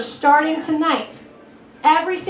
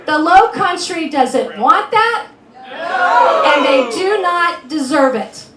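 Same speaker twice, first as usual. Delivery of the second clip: emphatic rally shout; 6 dB louder, slower than usual. Real, not acted. Rally attack on opponent.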